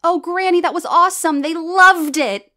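excited praise. Acted. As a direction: burst out with delight for Granny. Excited praise after set.

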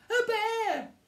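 very high, loud shriek; screamed. Acted. Eddie sees a gigantic bear, screaming. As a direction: scream it in storybook terror. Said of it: "screamed Eddie".

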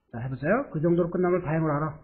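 ordinary talk, nothing marked

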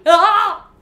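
short loud yelp; one sudden loud shout; energy outburst. Real, not acted. Vlogger venting energy after saying sorry, sudden yell. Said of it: "Energy outburst".

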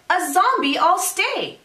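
put-on high-pitched, defiant little-boy cry; crying out in protest. A narrator's reading. Reader voices Jack defying his mother, high protesting cry. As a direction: insist on it, pitch up. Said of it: "Jack cried".

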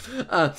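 long, low drawn-out groan; acted groan. Acting her still groaning, low mock groan. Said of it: "she's still groaning".

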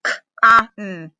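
K-A-N spelled letter by letter. Shown as a demonstration. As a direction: spell K-A-N out for the class. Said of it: "Our first syllable is con".

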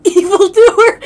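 laughing shout. Real, not acted. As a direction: yell it through laughter. Laughing mock villain callout.

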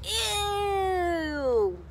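put-on a girl yelping in disgust; high squeal of ew; yelped. A narrator's reading. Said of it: "yelped Goldilocks".

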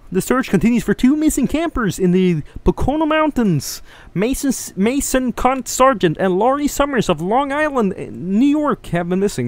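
high froggy puppet newsreader; Kermit voice. Pretend. Streamer reading a newspaper clipping as Kermit. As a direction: read the clipping like Kermit the Frog. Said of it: "why am I doing a Kermit voice?".

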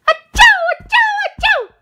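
three quick high sneezes in a row; sneezing fit. Acted. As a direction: sneeze again and again.

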